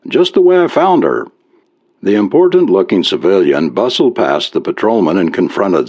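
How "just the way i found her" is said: lightly sarcastic, rising high; a mild dry jab at the trooper. A narrator's reading. Farmer mocking the trooper about his cow, mildly sarcastic. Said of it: "Zach spoke with sarcasm".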